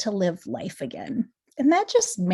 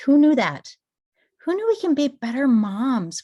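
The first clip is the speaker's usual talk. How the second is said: louder and much higher, amazed; amazed exclamation; 7 semitones higher, 5 dB louder than usual. Real, not acted. Telling viewers about the gut link, loud and amazed. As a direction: exclaim the surprise.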